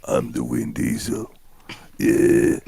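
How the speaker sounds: deep, slow growling voice; Vin Diesel growl. Retold or imitated. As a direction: growl it low like an action hero. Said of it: "stop with the growling voice".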